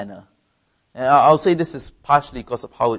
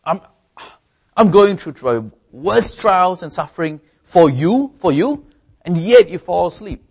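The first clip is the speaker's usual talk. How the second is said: higher, louder exasperated reproach; hurt rebuke; 6 semitones higher, 6 dB louder than usual. Pretend. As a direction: scold them for falling asleep, hurt. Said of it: "You might say".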